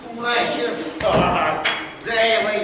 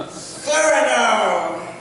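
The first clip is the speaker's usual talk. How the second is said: theatrical shout; 6 semitones higher, 4 dB louder, slower than usual. Acted. Declaims a role name.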